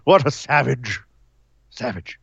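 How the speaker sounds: slow, prissy English gent aghast at Newcastle; mock posh British. Host lampooning a snooty British fan, mock posh British accent. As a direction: play a scandalised posh Englishman, lightly. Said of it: "All right, Nigel. Calm yourself".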